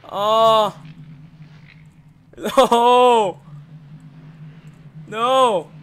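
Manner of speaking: high, loud cries of fright; frightened screams. Real, not acted. Streamer jolted by scares, crying out in fright. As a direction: shriek at each scare.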